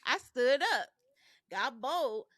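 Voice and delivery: ordinary delivery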